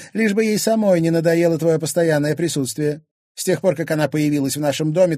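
high, loud nagging tirade; aggrieved wife. Acted. Wife jealous of husband's daughter, loudly aggrieved. Wife's quarrel voice.

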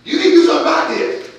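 loud, high-pitched demand on God; preacher shouting the prophet's complaint; desperate pleading prayer. Acted. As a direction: cry out to heaven, losing patience. Voicing Habakkuk's cry.

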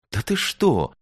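high indignant protest. A narrator's reading. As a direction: object in shocked disbelief. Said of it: "возмутилась Звёздочка".

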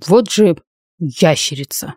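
slow hissed insult. A narrator's reading. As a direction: hiss it at him slowly. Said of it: "прошипел Харк".

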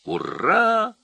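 very high, loud cheer; shouting with all might. A narrator's reading. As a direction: cheer at full pitch. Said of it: "закричал он изо всех сил".

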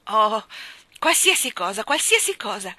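loud, expansive generosity; effusive agreement. Acted. King granting the cat anything at all, effusive. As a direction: burst out with a lavish promise.

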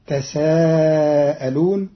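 تساءلون with a doubled, slowly drawn س; تساءلون said slowly with a geminated seen, rising at the end; shaddah on the seen. Shown as a demonstration. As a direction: stretch تساءلون with a stressed double s. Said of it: "بتشديد السين".

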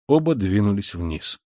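usual speaking voice

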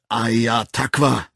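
slow foreign curse; slow scornful curse. A narrator's reading. The Savage cursing the Arch-Songster in Sunyi, slow. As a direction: spit out the words slowly. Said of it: "Nur auf Sunyi vermochte der Wilde angemessen auszudrücken".

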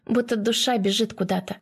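ordinary delivery

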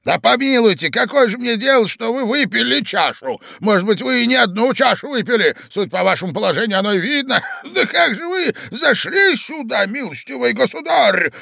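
high, agitated indignation with a laugh; old man shouting. A narrator's reading. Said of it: "кричал старик, весь дрожа". (старик кричит в волнении)

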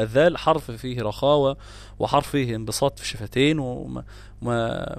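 ordinary talk, nothing marked

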